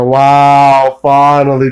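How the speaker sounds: loud exclamation. Real, not acted. Sarcastic exclamation mid-rant.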